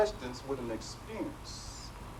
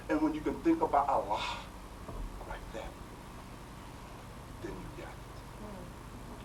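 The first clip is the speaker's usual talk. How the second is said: hushed lesson; 7 dB quieter than usual. Retold or imitated. Teacher's quiet final lesson.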